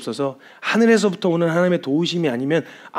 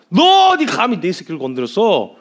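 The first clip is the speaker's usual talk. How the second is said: loud, raised-pitch outraged shout; stern warning; 4 semitones higher, 5 dB louder than usual. Acted. God confronts the Assyrian king over his child, angry warning. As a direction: thunder like a protective parent. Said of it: "주님이 엄히 경고를 하시는데".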